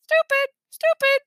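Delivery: high squawky bird voice; high parroted repetition; mynah bird calling. A narrator's reading. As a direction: squawk each word like a talking bird. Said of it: "he called".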